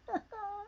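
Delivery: soft, high little laugh; amused chuckle. Real, not acted. After reading Janet's reply, soft giggle. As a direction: let out a small high giggle.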